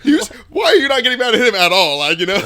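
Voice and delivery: indignant disbelief through laughter; laughing complaint. Real, not acted. Laughing to friends about unfair dad, loud high.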